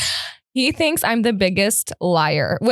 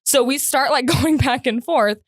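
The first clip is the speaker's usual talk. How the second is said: normal talking voice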